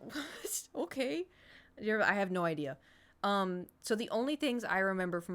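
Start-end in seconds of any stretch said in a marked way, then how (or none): 0.0-1.2 high, soft, puzzled questioning; reply in bemused disbelief; baffled reaction. Retold or imitated. Baffled by dad's remark, retold.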